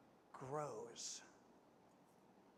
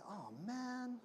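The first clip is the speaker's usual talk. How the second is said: softer, letdown sigh; deflated groan at the catch; disappointed; 5 semitones higher, 9 dB quieter than usual. Acted. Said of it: "he'd look at it disappointedly".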